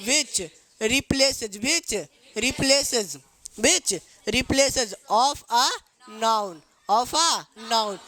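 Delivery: very slow, each word stretched; slow dictation. Shown as a demonstration. Teacher dictating the definition to pupils, very slowly. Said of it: "Which, क्या बोले?".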